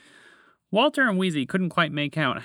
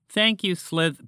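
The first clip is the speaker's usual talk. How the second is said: higher, slow thanks; grateful; 6 semitones higher, 3 dB louder, slower than usual. A narrator's reading. Frog thanks the snake for friend, grateful. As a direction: offer thanks deliberately.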